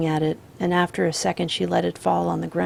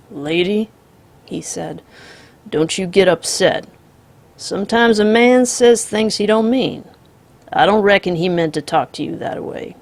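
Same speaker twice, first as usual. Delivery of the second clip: calm drawling outlaw; 6 dB louder than usual. Acted. The Misfit voiced.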